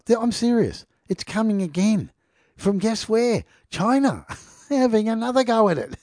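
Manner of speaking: can't believe it's happening again; incredulous excitement. Real, not acted. Reading the news to viewers, incredulous and high.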